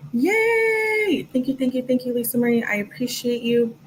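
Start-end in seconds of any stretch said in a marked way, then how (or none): 0.1-1.3 long, high-pitched celebratory yay; excited cheer. Real, not acted. Host cheers a viewer's gifted membership, excited. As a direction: squeal it out in celebration.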